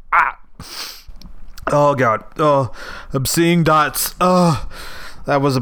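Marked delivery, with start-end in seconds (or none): none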